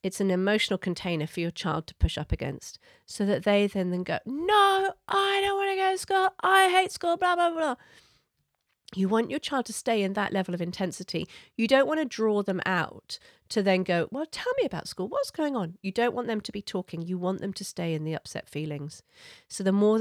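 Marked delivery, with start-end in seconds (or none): 4.2-7.8 put-on much higher, loud whiny child protest; upset child. Child pushing back against parent's limit, loud and high-pitched. 14.1-15.8 probing parent. Retold or imitated. Parent drawing child out.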